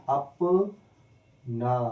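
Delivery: अप, a clear pause, then ना; pause between syllables. Shown as a demonstration. Teacher showing students the word split in two, with a pause between the halves. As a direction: say अप, stop briefly, then ना. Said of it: "यहाँ पे भी silent करूँगा".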